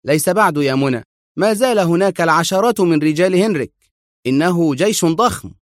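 lightly voiced stern hero. A narrator's reading. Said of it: "وهو يقول في صرامة".

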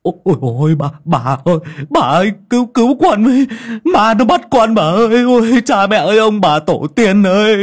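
high, loud frantic cries to grandma; panicked calling out. Acted. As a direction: wail for help in pure terror. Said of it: "hốt hoảng kêu lên".

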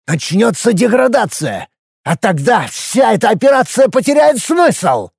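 worked up, high-pitched outburst; heated. A narrator's reading. Minister warns the commander the operation will fail, heated. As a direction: build up heatedly, voice climbing high. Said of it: "разгорячился Дуонг".

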